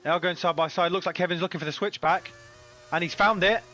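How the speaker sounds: rising excitement as the overtake unfolds; motorsport commentator. Real, not acted. Live call of an overtake.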